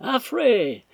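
very high-pitched call; calling from upstairs. A narrator's reading. Invalid mistress upstairs summons Affrey, calling out. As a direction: call the servant from another floor. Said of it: "se fit entendre d'en haut".

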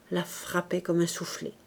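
usual speaking voice

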